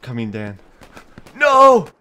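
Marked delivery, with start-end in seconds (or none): 1.3-1.9 higher, louder panicked yell; sudden high panicked shout; alarmed shout. Real, not acted. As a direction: yell the warning to the squad. Enemy suddenly behind him.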